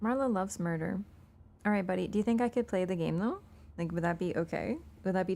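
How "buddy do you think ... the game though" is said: normal talking voice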